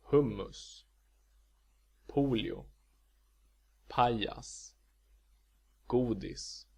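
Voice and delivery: Hummus, polio, pajas, godis, each with one tonal peak; Swedish accent one. Shown as a demonstration. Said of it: "words with two syllables".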